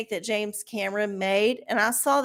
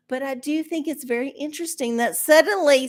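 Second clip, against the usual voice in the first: ordinary delivery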